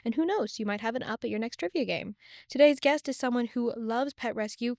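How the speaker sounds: polished, upbeat podcast host intro; scripted host welcome with lively emphasis; customer service voice. Acted. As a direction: greet listeners in your customer service voice, building to the guest's name. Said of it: "It's my customer service voice".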